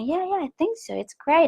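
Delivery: high, unconvinced yeah; lightly faked cheer to Mama; forced agreement. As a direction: humor mom without meaning it. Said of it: "I said to Mama".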